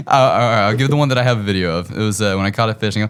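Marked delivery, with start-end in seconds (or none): none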